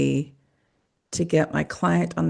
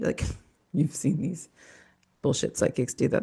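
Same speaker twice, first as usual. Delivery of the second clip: ordinary talk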